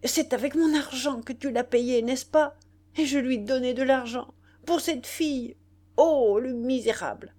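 a betrayed woman losing control; mounting fury. A narrator's reading. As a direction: let the rage rise line by line. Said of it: "s'emportant de plus en plus".

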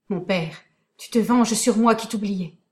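ordinary delivery

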